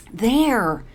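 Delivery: loud, high insistent exclamation; insisting. A narrator's reading. Caroline insists to Paralee where the wine is, loud. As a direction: insist and point firmly. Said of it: "Caroline insists".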